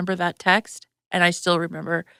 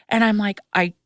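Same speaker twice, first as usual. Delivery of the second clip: usual speaking voice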